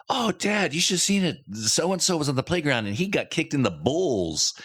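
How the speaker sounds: put-on high, eager kid voice, lightly done; imitating young son. Son tells his dad about the playground, excited kid voice. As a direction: gush like an excited boy. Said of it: "he's like".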